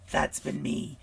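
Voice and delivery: ordinary talk, nothing marked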